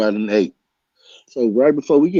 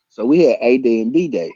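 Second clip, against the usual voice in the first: ordinary delivery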